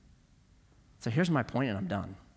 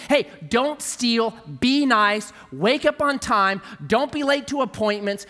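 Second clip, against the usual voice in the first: lecturing rules; 6 semitones higher, 3 dB louder, slower than usual. Retold or imitated. Quoting rules told to others.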